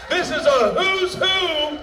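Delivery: big excited shout at the audience; excited hype. Real, not acted. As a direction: whip the crowd up like a hype man. Hyping the crowd at the start.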